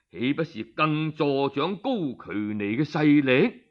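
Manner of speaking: ordinary delivery, nothing marked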